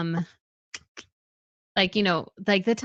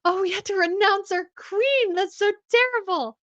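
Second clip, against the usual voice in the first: much higher, prim mock-tragic lament; mock Emily Blunt; 10 semitones higher than usual. Retelling the actress's oath story, much higher and mock-tragic. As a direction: play the dismay up sweetly. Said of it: "she's so cute 'cause she's like".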